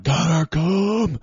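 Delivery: high, long drawn-out holler; slow, pleading retold yell; calling the dog desperately. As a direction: stretch each word out, calling the dog back. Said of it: "And I'm like".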